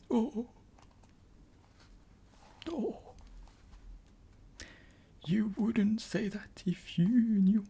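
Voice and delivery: high, slow sobbing words; a touch of weeping faun; between sobs. Acted. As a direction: speak through mild sobs. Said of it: "between his sobs".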